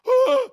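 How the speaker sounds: high surprised oh; startled man's cry. Retold or imitated. The scared man in the theater, high surprised cry. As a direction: yelp as if just scared. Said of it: "He goes".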